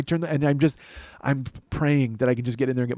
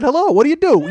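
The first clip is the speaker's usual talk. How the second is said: squeaky-high, loud fake friendliness; high forced cheery greeting; 14 semitones higher, 9 dB louder than usual. Shirtless guy greeting horrified debutantes, forced high cheer. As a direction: chirp it like a nervous host. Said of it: "I literally, I said".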